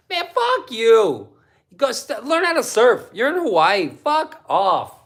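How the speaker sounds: mock angry rant. Real, not acted. Ranting at a skater.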